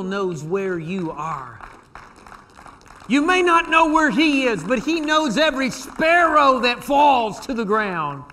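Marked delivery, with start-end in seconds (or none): 3.1-8.2 preaching peak. Acted. Preaching climax.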